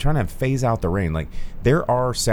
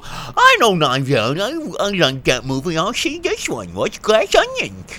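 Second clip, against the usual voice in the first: dumb moviegoer; 9 semitones higher than usual. Retold or imitated. Reading a fan's comment, he voices dumb moviegoers in a high dopey voice. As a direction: play a dim moviegoer. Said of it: "dumb people would go".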